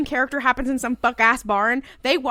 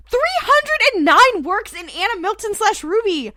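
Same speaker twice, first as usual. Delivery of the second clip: higher-pitched, delighted shout-out; excited announcement; 6 semitones higher, 3 dB louder than usual. Real, not acted. Reader hailing the fan writers after a search, excited announcement. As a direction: announce the find with real excitement.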